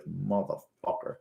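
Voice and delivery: muttered curse. Retold or imitated. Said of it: "You see him cursing underneath that shit".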